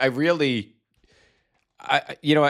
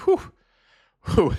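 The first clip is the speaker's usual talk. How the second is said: blowing out breaths after laughing; calm yourself down, exhaling; real recovery breaths; catching breath; 11 semitones higher, 3 dB louder than usual. Real, not acted. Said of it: "Deep breaths".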